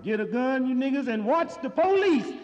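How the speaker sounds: loud, high call to arms; rousing mock proclamation; rallying shout. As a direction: yell it like a rally cry. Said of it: "Rap Brown said".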